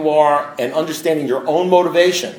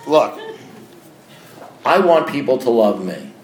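ordinary talk

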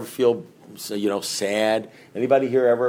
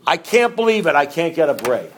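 higher, whiny poor-me voice; self-pitying whine; 6 semitones higher, 4 dB louder than usual. Retold or imitated. Recovering drinker mocking his own self-pity, whiny and higher. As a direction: whine like the world is against you. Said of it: "It sounded like this".

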